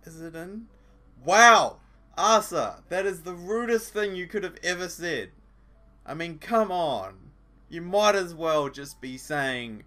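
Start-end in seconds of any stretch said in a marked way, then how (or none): 1.2-9.8 much louder, higher, mock-offended; outraged shout. Real, not acted. Commentator outraged at Asa's line, shouting. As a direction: blast it out in comic outrage.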